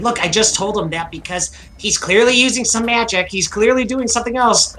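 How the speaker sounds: high, excited gnome voice; squeaky little gnome impression. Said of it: "That's not at all what I sound like".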